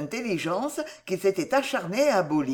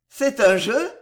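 put-on childlike amused question, higher and louder; 4 semitones higher, 6 dB louder than usual. A narrator's reading. Madman thinks the inquisitor is playing, amused. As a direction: perk up as if offered a game. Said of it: "demanda le fou d'un air amusé".

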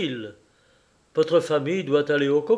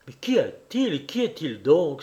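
angry outcry; 2 semitones higher than usual. A narrator's reading. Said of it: "s'écria le docteur, tout en colère".

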